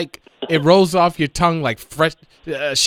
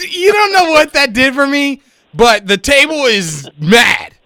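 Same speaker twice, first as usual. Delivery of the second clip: excited laughing; 9 semitones higher, 7 dB louder than usual. Real, not acted. Thrilled by the intro.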